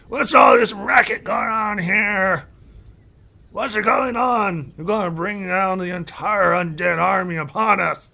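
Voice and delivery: put-on loud high old elf shout; yelling. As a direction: bellow it like a furious old elf. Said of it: "Mind you, he's yelling this out".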